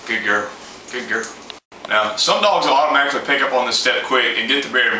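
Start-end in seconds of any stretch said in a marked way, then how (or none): 0.1-1.3 put-on soft, lower praise for a good girl; quietly reward her, softer and lower; gentle praise. Shown as a demonstration. Trainer softly praising his dog after she fetches, gentle and soft.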